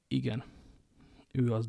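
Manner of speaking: a quiet grumbled admission; low grumble. Acted. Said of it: "dörmögte Atosz".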